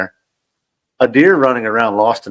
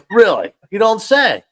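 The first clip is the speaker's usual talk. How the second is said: sarcastic; 11 semitones higher than usual. Retold or imitated. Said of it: "I'm over there laughing, going".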